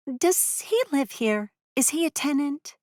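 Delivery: a renter fishing for gossip, high and dreamy; eager young woman. A narrator's reading. Woman asking the narrator about the man, high and eager.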